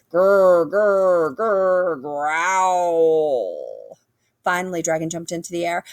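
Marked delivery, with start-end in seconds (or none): 0.1-4.0 low rumbling growl. A narrator's reading. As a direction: growl like a hidden beast. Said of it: "got louder and louder".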